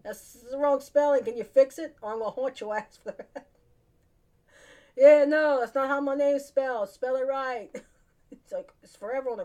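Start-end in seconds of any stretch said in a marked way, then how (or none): 0.0-3.5 loud, high, indignant ghost demand; a half-joking haunting, loud and high; ghostly complaint. Pretend. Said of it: "I'm popping out and saying". 5.0-7.7 high, loud mock outrage; loud playful ghost demand; loud scolding. Ghost scolding mourners, pretend.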